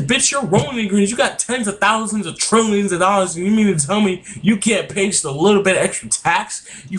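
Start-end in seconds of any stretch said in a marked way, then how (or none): none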